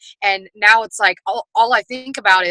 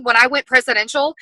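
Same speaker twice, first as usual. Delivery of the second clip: ordinary talk, nothing marked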